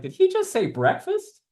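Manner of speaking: high-pitched baffled query; puzzled onlooker, pitch jumping up; incredulous. Acted. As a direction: squeak it in puzzled disbelief. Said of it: "he's like".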